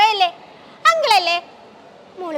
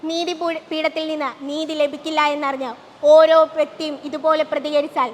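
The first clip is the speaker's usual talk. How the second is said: closing voiceover; 4 semitones lower, 3 dB quieter than usual. A narrator's reading.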